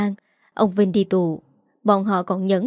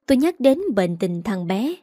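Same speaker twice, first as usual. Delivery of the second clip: usual speaking voice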